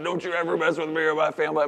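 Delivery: high, loud, rapid mock warning; tough-guy threat. Dad telling son how he pinned a monster, hammed-up threat. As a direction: warn the monster off, fast and loud.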